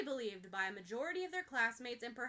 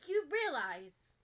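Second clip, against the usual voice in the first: brave start; 4 semitones higher, 5 dB louder, slower than usual. Acted. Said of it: "starts more bravely than she's able to finish".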